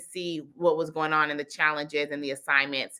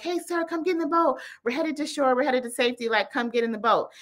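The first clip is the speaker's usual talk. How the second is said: higher voice hailing a stranded man; storyteller's voice for a helpful boater; rescuer calling out; 7 semitones higher than usual. Retold or imitated. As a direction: urge him aboard, pitched up.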